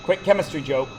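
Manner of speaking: ordinary talk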